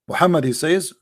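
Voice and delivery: ordinary talk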